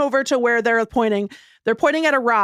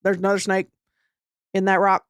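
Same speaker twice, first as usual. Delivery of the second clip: lightly voiced flat little boy, lower, quick; a hint of a stoic kid from the swamp, deadpan; mild imitation of an emotionless eight-year-old boy; Southern swamp boy; 5 semitones lower, faster than usual. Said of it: "impression of this boy".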